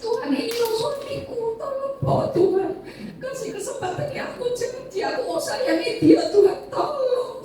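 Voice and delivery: high, soft sobbing plea; crying prayer. Retold or imitated. Wife praying for dying husband in church, sobbing. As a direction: beg God through sobs.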